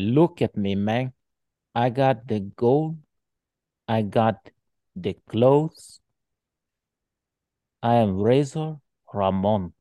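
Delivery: put-on slow, cocky Cuban-tinged Scarface accent; Razor Ramon impression. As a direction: swagger through it in a mock Cuban accent. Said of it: "it was the Tony Montana thing".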